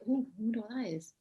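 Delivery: softly musing, pitched a little up; quiet, curious thought said to oneself; intrigued aside. Retold or imitated. Actor recalling spotting a course online, quietly intrigued.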